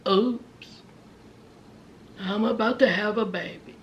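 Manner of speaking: soft, low, small admission; sheepish mock confession. Justine's sheepish confession, acted.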